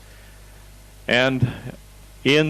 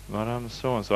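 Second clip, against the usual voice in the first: quiet puzzled echo; 9 dB quieter than usual. Retold or imitated. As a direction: echo the name under your breath. Speaker echoes the name to himself.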